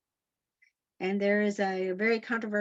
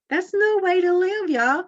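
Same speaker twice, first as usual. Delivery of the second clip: higher and louder, pressing the point home; rally them with a raised voice; emphatic exhortation; 8 semitones higher, 5 dB louder than usual. Real, not acted. Preacher urging the congregation, higher and louder.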